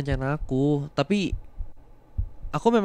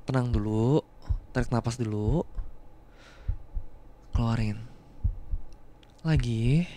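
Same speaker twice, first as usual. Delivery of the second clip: low, soft breathing coach; guided breathing; 5 semitones lower, 6 dB quieter than usual. Acted. Calming the crying partner through breathing, low and soft.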